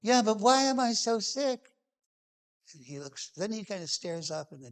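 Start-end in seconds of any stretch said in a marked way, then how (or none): 0.0-1.6 put-on higher, complaining childish whine; whiny child. Asking Muhammad why he is so sick, higher childish whine. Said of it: "I was still a whiny child".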